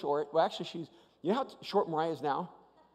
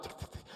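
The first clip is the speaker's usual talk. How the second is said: short quiet burst of ticks; soft mechanical tick-tick; imitated ride clicking; 14 dB quieter, faster than usual. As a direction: click like the flume climbing. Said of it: "It starts going up".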